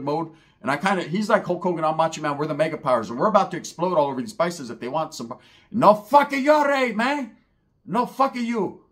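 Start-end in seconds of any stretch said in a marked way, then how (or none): 5.7-8.8 high, loud mocking shout; mock yell. Mocking the paisas' shouting, high and loud. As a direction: shout it like a street fight.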